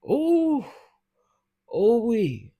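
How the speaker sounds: high loud shocked ooh. Real, not acted. Reacting to the shocking baby photo with a shocked squeal.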